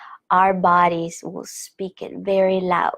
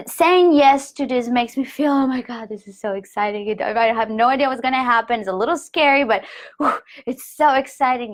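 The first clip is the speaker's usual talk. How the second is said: higher, eager excitement; excited voice going up; 3 semitones higher than usual. Shown as a demonstration. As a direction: let the voice lift with thrill. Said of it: "Your voice goes up".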